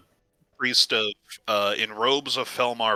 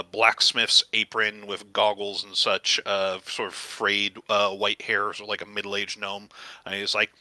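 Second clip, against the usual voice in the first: ordinary delivery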